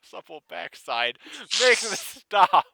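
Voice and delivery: high, loud anguished wail; screaming. Acted. Boy wailing for his dead daddy, hammed-up screaming. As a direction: howl it in hammed-up grief. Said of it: "Juxta screamed".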